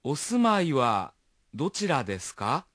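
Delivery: お住まいはどちらですか? said slowly, syllable by syllable; slow and careful model for learners; slow teaching pronunciation; slow version. Shown as a demonstration. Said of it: "Escuchemos una versión lenta".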